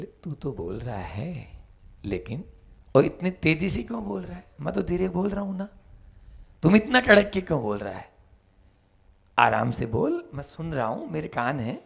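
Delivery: calm him down gently; soft calm. Acted. The monk gently telling the bandit to speak softly.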